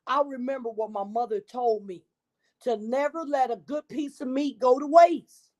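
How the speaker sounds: ordinary delivery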